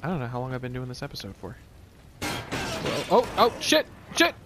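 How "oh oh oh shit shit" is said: high, loud startled swearing; jolted, high-pitched panic; panicked cursing. Real, not acted. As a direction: yelp it in rapid, rising bursts. Sudden danger in the game.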